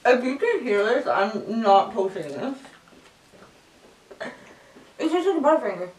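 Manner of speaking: chewing while talking; mouth full of chocolate. Real, not acted. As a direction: hand covering mouth. Seen 2: Left speaker keeps hand over her chewing mouth, holding a wrapped bar.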